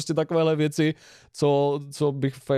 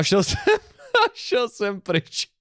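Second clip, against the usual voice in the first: amused storyteller breaking into a laugh; 7 semitones higher, slower than usual. Real, not acted. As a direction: say it through laughter, slowed down. Laughing at his own story. (se smíchem, pomalu)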